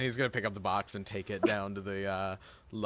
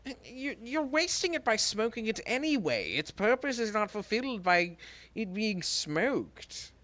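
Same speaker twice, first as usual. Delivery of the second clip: argumentative; 11 semitones higher than usual. Acted. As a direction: push back, pitch up. Heated in-character argument.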